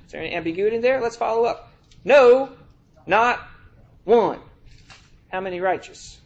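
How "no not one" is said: loud, spaced-out no, not one; preacher driving the verse home; emphatic scripture. Acted. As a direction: punch each word apart. Reading Romans aloud.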